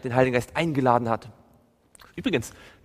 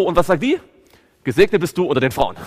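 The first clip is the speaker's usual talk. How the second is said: loud, driving sermon retelling; fervent preaching; 3 semitones higher, 7 dB louder than usual. Acted. Preacher to his congregation, loud fervent storytelling.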